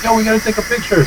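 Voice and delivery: high, quick starstruck voice; imitated happy fan. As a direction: rush it out as a thrilled fan. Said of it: "he was all happy as hell".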